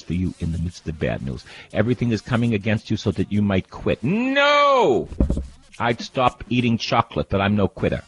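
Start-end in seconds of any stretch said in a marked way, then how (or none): 4.0-5.1 high, loud cry of no; defiant shout. Real, not acted. Preacher rejects giving up, a high loud shout. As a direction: shout down the idea of quitting. Refusing to quit.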